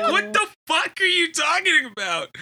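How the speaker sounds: high, laughing disbelief; baffled laughing retort. Retold or imitated. Kid confronting a classmate about Pancho Claus, high laughing disbelief. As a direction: laugh through the incredulous question. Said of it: "And I was like".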